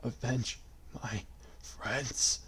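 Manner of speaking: faint gasped words; gasping. A narrator's reading. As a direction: gasp it with last breath. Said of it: "Mataris gasped".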